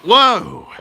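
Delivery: hammed-up surprised whoa; mock amazement. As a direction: react big with a punchy high whoa. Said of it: "And then you were like".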